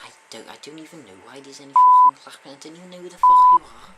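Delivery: loud, fast furious outburst; angry shout. Acted. Jeff Tate rounds on the octopus, shouting. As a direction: yell at the intruder.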